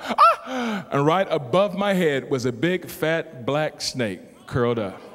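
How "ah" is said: short, high mock-startled yelp; acted gasp of alarm. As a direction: cry out as if jumping back in shock. Startled on seeing the snake.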